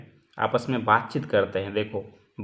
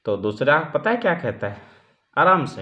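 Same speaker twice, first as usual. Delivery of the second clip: ordinary delivery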